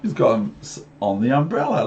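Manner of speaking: ordinary delivery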